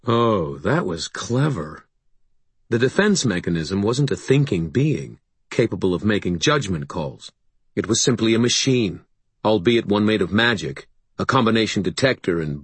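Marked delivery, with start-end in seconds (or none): none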